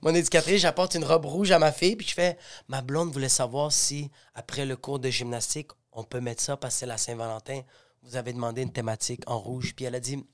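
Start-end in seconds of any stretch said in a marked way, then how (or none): 2.7-9.7 low, very soft retold request; timid request. Father asking his daughter's educator about a dress, timid request. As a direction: ask the teacher softly, keeping it low.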